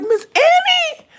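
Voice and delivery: a high, loud, drawn-out greeting; excited greeting. Retold or imitated. Greeting a coworker with new braids, an excited high call. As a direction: call her name out high and loud, thrilled. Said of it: "I was like".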